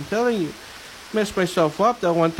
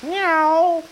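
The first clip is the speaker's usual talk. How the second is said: cat meow; 10 semitones higher than usual. Pretend. Cat sound in poem.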